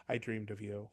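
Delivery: low, hushed, tender admission; quiet confession. Acted. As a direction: let it drop softly, simply said. Simple heartfelt confession acted out.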